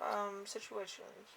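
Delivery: ordinary delivery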